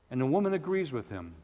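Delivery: ordinary talk, nothing marked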